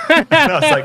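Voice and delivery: real laughter breaking into the words; laughing. Real, not acted. Cracks up while retelling the gym chat, laughing. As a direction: laugh it out, high and loud. Laughing at his own story.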